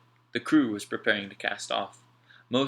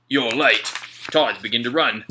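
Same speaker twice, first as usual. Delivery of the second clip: Slank, harsh boss; 2 semitones higher, 11 dB louder than usual. A narrator's reading. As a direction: scold the late men. Slank scolding.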